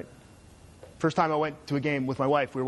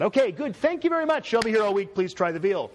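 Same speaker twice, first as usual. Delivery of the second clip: comedian's sign-off; 6 semitones higher, 3 dB louder than usual. Pretend. Stand-up comic closing line parody.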